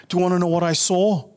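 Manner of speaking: teasing the big reveal. Acted. Building to the reveal.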